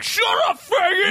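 high angry shout. Retold or imitated. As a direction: yell it like a thug. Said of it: "They didn't turn around like".

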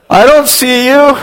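high, slow and puzzled; a kid scanning the parking lot; lost kid on the phone. Retold or imitated. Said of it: "And he goes".

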